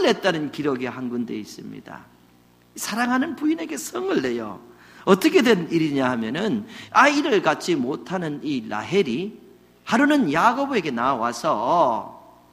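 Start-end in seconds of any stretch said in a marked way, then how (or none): none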